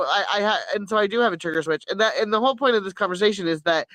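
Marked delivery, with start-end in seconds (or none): none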